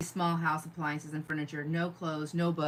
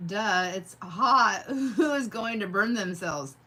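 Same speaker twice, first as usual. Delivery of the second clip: slow, louder mock scoff, breaking into a laugh; judgy thought; 2 semitones higher, 4 dB louder, slower than usual. Retelling a viewer's smug inner voice with a laugh. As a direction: drag out the smug duh. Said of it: "judgy thought".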